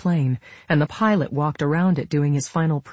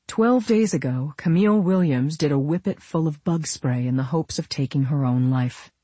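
ordinary delivery

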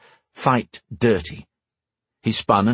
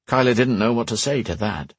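ordinary delivery, nothing marked

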